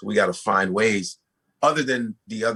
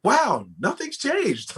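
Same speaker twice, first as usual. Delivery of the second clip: amazed wow; 14 semitones higher, much slower than usual. Retold or imitated. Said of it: "you go".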